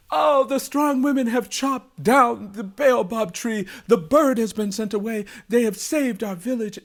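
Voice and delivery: high, loud, jubilant king; overjoyed. A narrator's reading. The king greets the returning women, overjoyed. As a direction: cry out in royal triumph. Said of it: "he was overjoyed".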